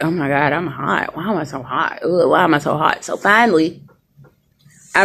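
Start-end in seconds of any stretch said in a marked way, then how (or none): 0.0-3.0 quiet inner voice. Retold or imitated. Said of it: "all I kept thinking was like".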